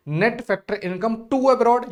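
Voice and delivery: dictating a key term slowly; slow dictation. Shown as a demonstration. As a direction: spell the term out for students. Reading exam term slowly.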